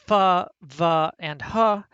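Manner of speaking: f, v and h each sounded loudly as a consonant with "uh"; fricative sounds demonstrated. Shown as a demonstration. Professor showing students the fricatives, sounded out loudly. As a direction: sound out f, v, h as fuh, vuh, huh. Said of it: "The fricatives".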